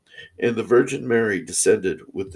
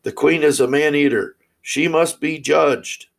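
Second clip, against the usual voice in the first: higher, louder accusing cry; raised voice of an accusing people; loud crowd cry; 6 semitones higher, 4 dB louder than usual. A narrator's reading. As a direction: cry it out loudly, pitched up. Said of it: "the people cried loudly".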